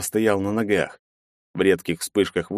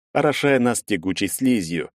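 plain reading voice

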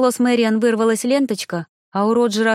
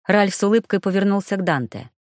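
plain reading voice

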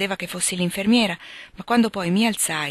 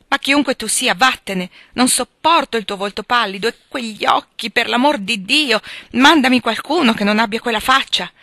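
loud, higher, desperate order to leave; raised voice, exasperated and pleading; agitated outburst; 5 semitones higher, 8 dB louder than usual. Acted. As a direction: drive them away in feverish revulsion. Delirious sick man sends her away.

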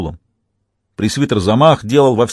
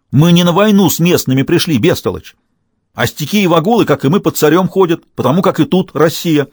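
raised, higher-pitched lecturing; angry dressing-down; 6 semitones higher, 3 dB louder than usual. A narrator's reading. Commander telling soldier these natives are not enemies, angry scolding. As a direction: berate them emphatically. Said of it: "заорал на него сотник".

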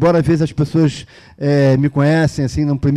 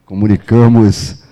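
airport announcer; 5 semitones lower, 4 dB louder, slower than usual. Pretend. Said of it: "que nem a mulher do aeroporto".

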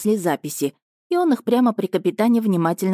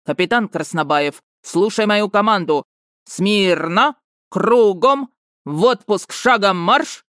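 drill commands; 3 semitones higher, 4 dB louder, slower than usual. Acted. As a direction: bark parade-ground drill orders. General barking drill commands.

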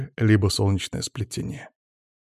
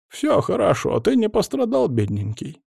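high, tender calling to a cat; granny calling her cat; cooing granny; 10 semitones higher than usual. A narrator's reading. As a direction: coo to the cat like a grandma.

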